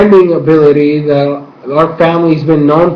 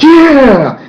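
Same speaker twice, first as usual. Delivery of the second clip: excited cheer; 9 semitones higher, 4 dB louder than usual. Retold or imitated. Mark cheering Korra's plan.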